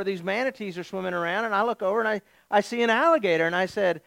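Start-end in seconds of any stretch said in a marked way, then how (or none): none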